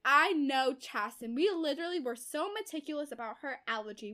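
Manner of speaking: indignant outburst. Retold or imitated. Retold mother insisting on her daughter's allergy, indignant. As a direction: protest emphatically, leaning hard on key words. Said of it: "Verity was all like".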